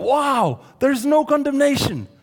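mock wonder at no condemnation; mock amazed wow. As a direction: gush like a crowd fixated on the word. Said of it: "We focus so much on that".

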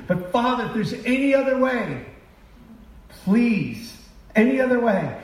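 high, strained plea; pleading prayer. Retold or imitated. Jesus praying the night before, pleading high. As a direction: beg the Father for another way.

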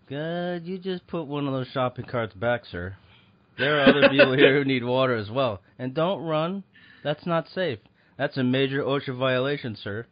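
stern mall cop ordering a shopper; firm, loud, authoritative guard; security guard voice. Pretend. Mock security guard scolding shopper.

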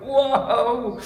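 high, loud astonished whoa; laughing burst of amazement; amazed whoa. Retold or imitated. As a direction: gasp out a stunned whoa. Shocked reaction to the picture.

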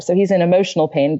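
ordinary talk, nothing marked

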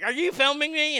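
mock-outraged challenge to someone filming; accusing Karen. Mimics Karen confronting the camera.

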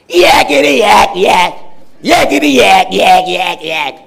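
loud, high nauseating yakking; shrill demon voice. Acted. Preacher mimics the demon drowning out Jesus, shrill and loud. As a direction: screech it like a pestering demon. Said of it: "nauseating, disgusting sound".

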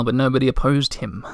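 plain reading voice

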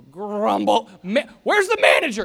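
mock outburst, loud and high; losing it. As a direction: yell like a grumbling customer demanding the manager. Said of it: "You start losing it".

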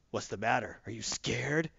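soft, understated villainous needling; lightly voiced cool threat; mock menacing taunt. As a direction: taunt quietly after the squealing. Heroine taunting beaten villain.

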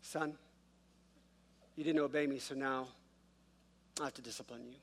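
lightly voiced patient dad disciplining his son; perfect calm dad tone. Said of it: "this perfect tone".

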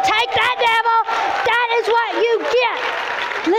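high, slow victorious shout; triumphant shout. Retold or imitated. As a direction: crow at the devil in triumph. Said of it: "I'm like".

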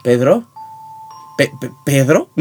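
stammering fear. Acted. Scared horror victim calling.

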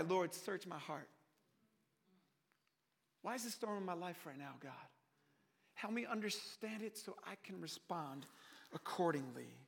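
low, soft plea to God; hushed prayer. Acted. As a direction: pray it quietly, pitched well down. Earnest prayer voiced for the congregation.

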